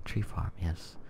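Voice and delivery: flat, even monotone. Shown as a demonstration. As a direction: drone the words without melody. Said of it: "With my monotone voice".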